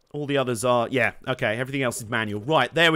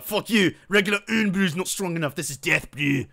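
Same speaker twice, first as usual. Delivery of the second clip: mock-angry Scottish accent, not shouted. Streamer voices angry Scots defending their drink, mock Scottish accent. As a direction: put on a lightly irate Scottish accent. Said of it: "Like the Scottish just got angry".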